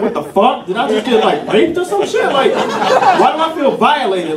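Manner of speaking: baffled protest; acted outrage. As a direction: climb in pitch as the outrage grows. Acting out his shocked reaction.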